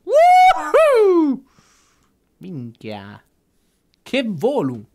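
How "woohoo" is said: high, loud whoop; excited whoop. Real, not acted. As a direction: let out a big whoop. Cheer on a big jump.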